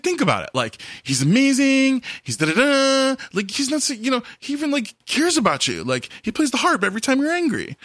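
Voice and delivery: put-on high, pleading kid voice; whiny son voice. Son begging his dad to spare David, whiny and high.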